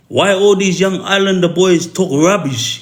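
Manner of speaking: indignant Serbian accent, lightly imitated. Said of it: "this is the way he talks, his accent".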